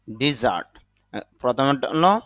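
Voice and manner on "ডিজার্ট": ডিজার্ট with "di-", pitched lower; verb pronunciation. Shown as a demonstration. Teacher repeating the verb form for learners, carefully pronounced. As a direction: say ডিজার্ট as "di-zart", i in the first syllable. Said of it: "এখানে উভয়টাই উচ্চারণ দেওয়া হয়েছে".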